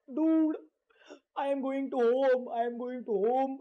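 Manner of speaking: slow, dragged-out tearful whine; mock teary. Arya crying to her friends that she wants to go home, mock tearful. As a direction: imitate someone sniffling that she wants to go home. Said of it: "Arya परत रडत असते".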